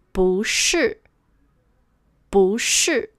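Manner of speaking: 不 rises, then 是 falls; rising second-tone 不 in 不是. Shown as a demonstration. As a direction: lift 不 before falling 是. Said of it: "into the second tone".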